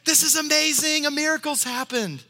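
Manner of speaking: high, loud, overjoyed announcement; excited rooftop shout. Pretend. Preacher imagines Elizabeth announcing her pregnancy, excited high shout. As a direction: shout the amazing news from the rooftops. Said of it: "shouting from the rooftops".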